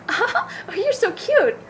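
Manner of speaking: high, slow, giggly airhead gush; mock ditzy cooing through a laugh; ditzy girl voice. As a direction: coo it like the naive girl next door. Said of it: "ditzy kind of naive girl".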